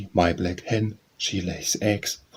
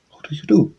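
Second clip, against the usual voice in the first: bright greeting to a bird; 5 semitones higher, 6 dB louder, faster than usual. A narrator's reading.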